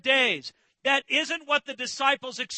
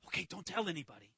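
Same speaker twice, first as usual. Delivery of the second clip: hushed, quick and much softer; low voice; 7 semitones lower, 14 dB quieter, faster than usual. Retold or imitated. Jesus telling the disciples to keep quiet, in a hushed voice. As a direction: drop it low, a quick secret aside. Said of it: "says in a low voice".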